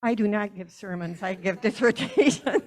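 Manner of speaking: loud mock correction, breaking into a laugh; mock stern. Next minister correcting a congregant's compliment, loudly mock-stern. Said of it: "very sternly said".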